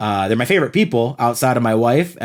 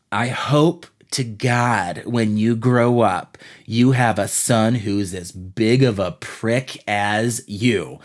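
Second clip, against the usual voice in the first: calm mother; 5 semitones lower, slower than usual. Retold or imitated. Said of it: "very calmly says".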